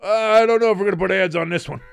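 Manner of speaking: high, loud, prissy corporate voice; a hammed-up corporate gatekeeper; mock YouTube voice. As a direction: play the fussy platform rep. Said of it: "even if YouTube says".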